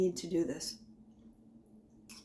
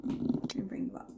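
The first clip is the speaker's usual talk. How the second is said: soft breathy whisper; 5 dB quieter than usual. Shown as a demonstration. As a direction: drop to a whisper. Says it twice, second whispered.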